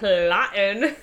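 drawn-out, playfully sinister word; mock sinister. Joking about lurking in a friend's driveway, drawn out. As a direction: stretch out PLOTTING as a joke.

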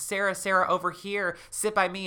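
high, beckoning ghostly call. A narrator's reading. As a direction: call her over in a high voice. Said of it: "a voice calls out to her".

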